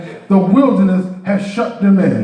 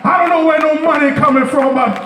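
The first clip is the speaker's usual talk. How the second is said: building sermon; 5 semitones higher than usual. Acted. Preacher declaring God's provision, rising preaching. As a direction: drive each line home to the church.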